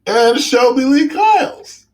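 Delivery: high, drawn-out mock announcement of a name; playful intro. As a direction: sing out the name high and slow, held on one note. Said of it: "I'm doing it another way".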